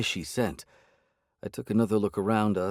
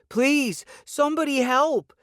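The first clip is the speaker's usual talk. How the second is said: much higher, louder, panicked scream; terrified scream; 4 dB louder, slower than usual. A narrator's reading. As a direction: scream at attackers in terror. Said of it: "she shouted".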